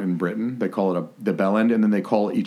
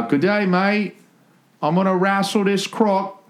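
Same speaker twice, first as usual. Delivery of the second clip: high, hammed-up Aussie; broad mock Australian, pitched way up; Australian accent; 11 semitones higher than usual. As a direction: play a cartoon Australian croc wrestler. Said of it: "'cause you said Australian".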